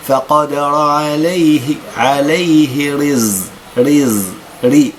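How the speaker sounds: عليه رز recited slowly with a light thin ra; slow Quran recitation. Shown as a demonstration. Teacher reciting the verse word by word. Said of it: "الراء مرققة بسبب أنها مكسورة".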